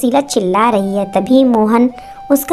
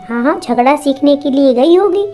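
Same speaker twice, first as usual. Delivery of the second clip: mocking taunt; 5 semitones higher than usual. A narrator's reading. Mother-in-law mocks daughter-in-law.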